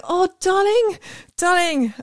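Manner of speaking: high, sugary mock-cooing darling; cooing mother. Mum mock-cooing to her sick child. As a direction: coo it sweetly while holding back. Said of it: "So you're like".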